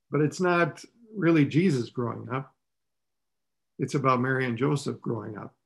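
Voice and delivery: ordinary talk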